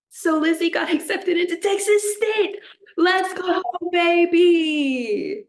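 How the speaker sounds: high, loud cheer building to a shout; real shout of joy for a friend; excited cheering. Real, not acted. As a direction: hype her up like a stadium crowd. Cheering friend's college acceptance.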